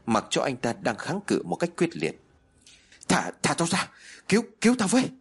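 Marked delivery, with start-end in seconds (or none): 3.1-5.1 desperate cry for help, rising to a scream; panicked plea breaking into a shriek; terrified screaming. Acted. Worker being held and chopped.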